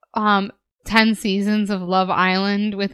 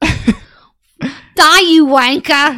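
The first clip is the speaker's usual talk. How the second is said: loud, drawn-out mock British yell; hammed-up British accent; 6 semitones higher, 9 dB louder, much slower than usual. Podcaster imagining a killer's first words, mock British accent. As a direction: bellow it in a bad British accent. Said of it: "a British accent".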